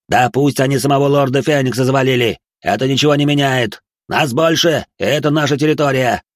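angry shout. A narrator's reading. Merchant shouts defiance.

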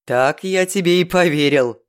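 sneering disbelief; smirking. Acted. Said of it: "ухмыльнулся державший мальчика".

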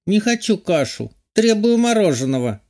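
put-on high whiny spoiled child voice; whiny tattling little sibling. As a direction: whine it like a bratty kid. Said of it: "произнесла голосом капризного ребёнка".

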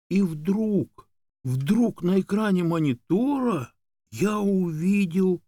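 high, drawn-out breathless anticipation; suspenseful build-up. A narrator's reading. Dog narrator building to what he saw on screen, suspenseful. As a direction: stretch the words out high before the reveal.

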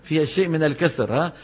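ordinary talk

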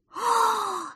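drawn-out high frightened "aah"; high scream. Acted. Prostodursen sees pretend lion, high scream. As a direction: let out a high mock-terrified scream.

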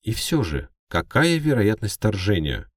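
high, loud newsreader tone; news anchor. Acted. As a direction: ask it like a TV anchor.

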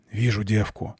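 soft, distant voice over radio; quiet radio callout. A narrator's reading. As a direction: report in a hushed radio murmur. Sniper reports quietly on radio.

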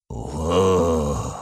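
low satisfied monster growl; pleased growl. A narrator's reading. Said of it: "довольно прорычал пришелец". (довольный рык)

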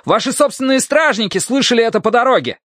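high, sustained shout; shouted accusation. Acted. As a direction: call it out high, pressing the accusation. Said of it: "крикнул лучник".

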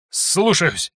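high, trembling obedient reply; shaky voice. A narrator's reading. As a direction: answer shaking with fear. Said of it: "дрожащим голосом ответил мастер".

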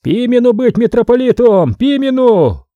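high-pitched acted bawl; yelling. A narrator's reading. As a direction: yell your candidate's name across the crowd. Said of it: "заорал".

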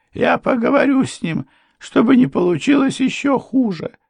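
high-pitched, resolved to talk; anxious wife. A narrator's reading. Mother will talk to him, high anxious voice. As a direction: keep it high and urgent.

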